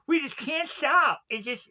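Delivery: high, silly put-on voice; mock-helpless comic voice; silly funny voice. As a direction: put on a goofy voice for laughs. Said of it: "have to do this voice".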